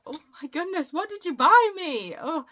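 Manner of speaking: high, loud squeal of real gratitude; overwhelmed delight. Real, not acted. Vlogger opening a friend's surprise birthday parcel, overwhelmed delight. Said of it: "I'm trying not to cry".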